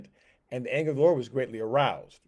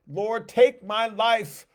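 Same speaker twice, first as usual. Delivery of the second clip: slow, much higher, pleading; despairing plea; 8 semitones higher, 7 dB louder, much slower than usual. Acted. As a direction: drag the words out as if you have nothing left. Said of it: "he prayed that he might die".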